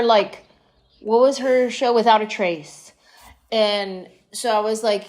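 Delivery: normal talking voice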